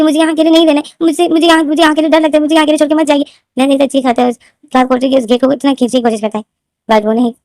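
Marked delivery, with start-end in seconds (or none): 0.0-3.2 mock frightened scream. A narrator's reading. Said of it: "लेनदेन चीख रहा होता है कि".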